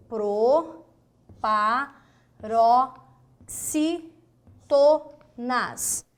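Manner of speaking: Proparoxítonas drawn out very slowly, syllable by syllable; divided into syllables. Shown as a demonstration. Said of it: "vamos dividir?". (Proparoxítonas separada em sílabas, bem devagar)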